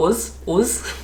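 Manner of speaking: "us" ending in a buzzed z, "uz"; northern "uz". Shown as a demonstration. Imitating northerners for viewers, "us" said as "uz". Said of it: "like, they'll go uz".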